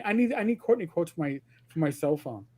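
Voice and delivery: ordinary talk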